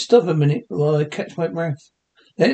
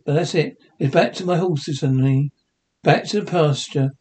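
normal talking voice

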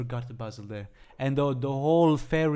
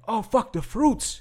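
high, slow mock dismay; dismayed realisation; 10 semitones higher, 3 dB louder, slower than usual. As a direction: act out suddenly remembering something. Acted sudden realisation in story.

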